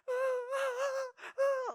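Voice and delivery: high-pitched mock bawling; wordless put-on crying, high and stretched; imitated sobbing wail. Brother imitating little sister running in crying, sobbing. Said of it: "just crying, sobbing".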